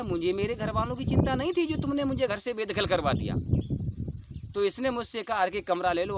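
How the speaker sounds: normal talking voice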